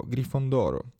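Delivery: plain reading voice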